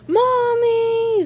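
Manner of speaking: very high, loud wail of terror; high-pitched terrified scream; terrified cry. A narrator's reading. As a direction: cry out for mommy in a panic. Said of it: "Squidward cried, terrified".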